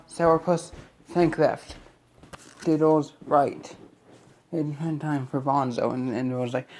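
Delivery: ordinary talk, nothing marked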